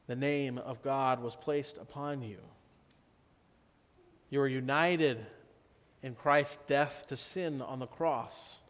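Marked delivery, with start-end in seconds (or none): none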